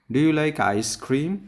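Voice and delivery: slow question that hits "ice cream"; stress on ice cream. Shown as a demonstration. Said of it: "আইসক্রিমের উপরে stress দিব". ("ice cream"-এ জোর দিয়ে)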